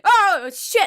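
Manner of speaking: loud, high startled outburst; retold shocked yell; startled yelp. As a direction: cry out as if something jumped at you. Startled as she lunges in.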